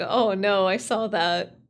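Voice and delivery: usual speaking voice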